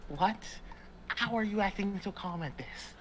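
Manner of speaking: high-pitched, incredulous panic; freaking out. Acted. Pam to the calm player, high-pitched freak-out. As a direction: stammer out the questions in rising alarm. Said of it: "freaking out even more".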